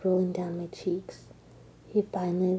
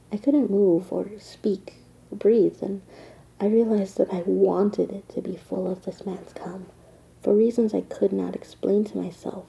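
erotic whisper; 5 dB louder than usual. Acted. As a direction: whisper it like a secret.

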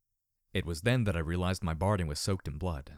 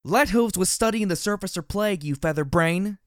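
loud, high, furious roar; 9 semitones higher, 7 dB louder than usual. Acted. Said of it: "Dusk roared".

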